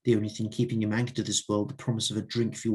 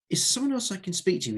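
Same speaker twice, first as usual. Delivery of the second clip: mildly irritated, pitched up; beginning to fume; 8 semitones higher than usual. A narrator's reading. Author asking for someone else, starting to fume. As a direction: let a touch of exasperation lift the voice. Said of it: "he asked, beginning to fume".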